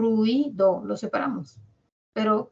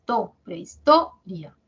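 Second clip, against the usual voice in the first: To, prehistoria slowly, To hit hard; slow emphatic teacher demo; stress on to; 2 semitones lower, 4 dB louder, slower than usual. Shown as a demonstration. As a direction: punch the To in prehistoria. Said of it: "Toria tiene la mayor fuerza de voz".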